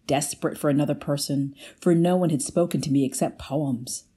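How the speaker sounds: ordinary delivery, nothing marked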